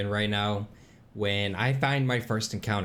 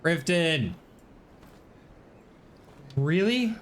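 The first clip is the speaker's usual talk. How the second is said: frustrated yelling; 11 semitones higher, slower than usual. Real, not acted. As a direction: yell it out in exasperation. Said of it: "let myself take over from here".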